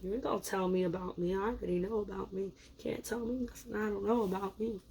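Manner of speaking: quiet, self-satisfied mumble; smug mutter. Retold or imitated. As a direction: grumble it under your breath as a smug sign. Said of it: "Scorpio's over here".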